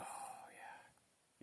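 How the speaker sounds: breathy voiceless "Oh, yeah."; whisper. Retold or imitated. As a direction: breathe it out like a spirit answering. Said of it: "and the spirit just go".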